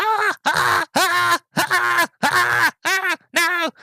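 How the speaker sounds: very high repeated screeches of a burning vampire; mock agonised screams. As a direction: imitate shrieking in pain under burning light. Imitates vampire burned by UV light.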